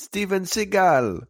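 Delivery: Steven Seagal in a Jamaican accent. Shown as a demonstration. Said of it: "if you're saying it in a Jamaican accent".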